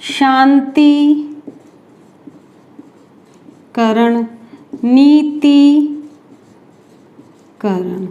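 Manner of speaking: very slow, each syllable stretched; drawn-out demo. Shown as a demonstration. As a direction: stretch every syllable as you teach. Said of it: "ध्यान में रखना". (हर शब्द बहुत धीरे खींचकर)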